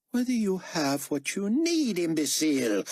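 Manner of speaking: high, exasperated imperious rebuke; irritated berating. Acted. Carpathia berates the nervous young man.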